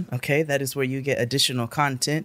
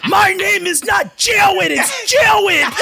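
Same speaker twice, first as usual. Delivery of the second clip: high, drawn-out yell with R's as W's; enraged kid impression; 14 semitones higher, 5 dB louder, much slower than usual. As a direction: shriek the correction as the furious classmate. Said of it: "he was enraged".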